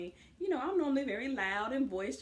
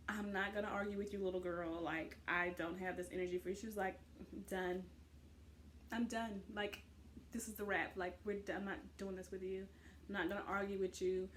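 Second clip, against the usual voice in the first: calm dismissal; 3 semitones lower, 9 dB quieter, faster than usual. Retold or imitated. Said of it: "Her energy is just so calm".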